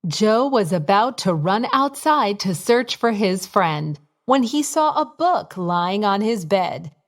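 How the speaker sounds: usual speaking voice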